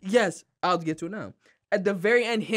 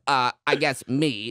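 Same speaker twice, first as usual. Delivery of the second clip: lower, sheepish Frank voice; imitating Frank; 7 semitones lower than usual. Retelling the scorched wall scene, sheepish lower Frank. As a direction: admit it sheepishly and low. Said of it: "And Frank goes".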